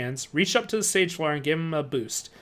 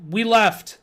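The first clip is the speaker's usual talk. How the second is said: blurting; 5 semitones higher, 5 dB louder than usual. A narrator's reading. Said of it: "I blurted out".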